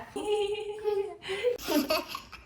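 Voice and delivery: high giggling laugh. Shown as a demonstration. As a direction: giggle on cue. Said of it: "Can you giggle and laugh?".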